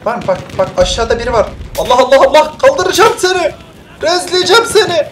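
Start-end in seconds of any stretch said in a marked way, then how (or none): 1.8-5.1 excited calling out. Real, not acted. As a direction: call out while rushing in. Takım arkadaşını kaldırmaya koşuyor. (heyecanla bağırarak çağırma)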